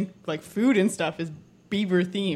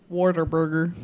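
Whataburger said as wor-ta-burger in a thick Texas accent. Shown as a demonstration. Host showing friends a thick Texas accent. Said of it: "a thick Texas accent".

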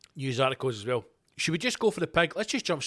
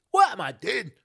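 alarmed self-question; 5 semitones higher, 6 dB louder than usual. Retold or imitated. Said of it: "I'm like".

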